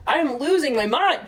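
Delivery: high, real exasperated outburst; frantic exasperation. Real, not acted. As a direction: let frustration push the pitch up. Frantically searching for the report.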